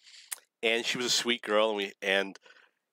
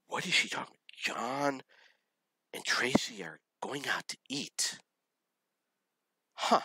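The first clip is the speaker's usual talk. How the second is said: baffled whisper; 5 semitones higher, 3 dB quieter than usual. Retold or imitated. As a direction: whisper it as a bewildered inner thought. Retold baffled private thought.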